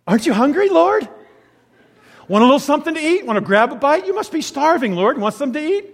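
high, loud, fussy coaxing; fussing disciples. Acted. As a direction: coax him to eat like worried mothers. Disciples fussing over Jesus.

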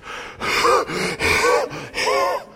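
long airless wheezing gasp; exhausted gasp. Retold or imitated. Craig collapsing at the edge of the track, exhausted gasp. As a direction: gasp out like you're spent.

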